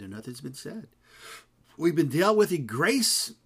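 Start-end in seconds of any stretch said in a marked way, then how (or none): 1.8-3.3 preaching. Acted. Preaching emphasis.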